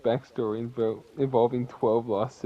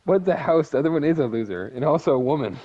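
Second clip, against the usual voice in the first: louder, higher playful jab; loud teasing; 5 semitones higher, 7 dB louder, faster than usual. Real, not acted. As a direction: tease loudly. Teasing friend about characters.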